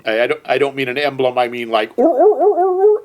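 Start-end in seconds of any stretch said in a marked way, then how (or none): 2.0-3.0 high honking seal bark. Retold or imitated. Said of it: "kind of seal".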